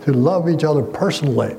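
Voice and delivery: normal talking voice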